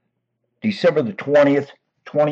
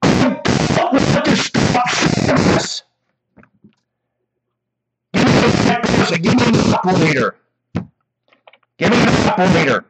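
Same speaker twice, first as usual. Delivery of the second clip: frustrated; 8 semitones higher than usual. Real, not acted. Caller demands operator.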